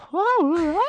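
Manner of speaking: high confused what; squeaky puzzled what; baffled high what. Acted. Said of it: "he's just turning around and like".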